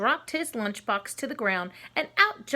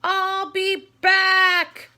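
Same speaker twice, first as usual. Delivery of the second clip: high, loud, slow scream; loud stretched villain scream; 9 semitones higher, 11 dB louder, much slower than usual. Acted. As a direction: scream it while falling away. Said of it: "Reptilian screamed as he fell".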